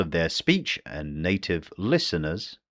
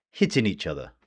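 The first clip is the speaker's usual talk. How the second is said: ordinary talk, nothing marked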